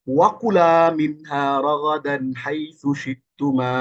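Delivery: slow chanted Arabic recitation of a verse; Quranic recitation. Shown as a demonstration. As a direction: recite it slowly like tajweed.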